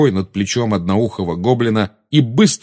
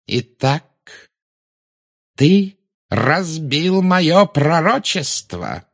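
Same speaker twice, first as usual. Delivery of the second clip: very slow, silky menace; Voldemort questioning Harry; silky insinuating; 8 semitones higher, much slower than usual. Acted. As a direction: purr the question, slow and cold. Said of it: "вкрадчиво спросил".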